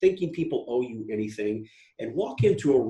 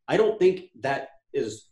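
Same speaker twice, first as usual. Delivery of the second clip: ordinary delivery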